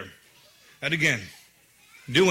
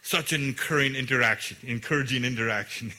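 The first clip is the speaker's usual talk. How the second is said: dry sarcasm; 7 semitones lower, 3 dB quieter, faster than usual. Real, not acted. Ironic comment on the grim reply.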